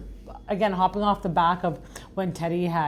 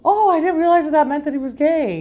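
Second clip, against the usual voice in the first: mock surprise; 9 semitones higher, 8 dB louder than usual. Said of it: "I'm like".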